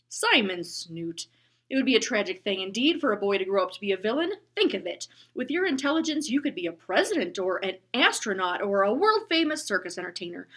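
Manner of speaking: loud, booming lecture to a bully; stern bus driver. Acted. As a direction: scold him grandly and firmly.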